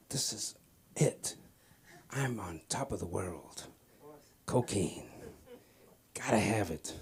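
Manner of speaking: slow, low, hushed revelation; hushed awe. Retold or imitated. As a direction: savour it softly, with long pauses. Acting out the cocaine high.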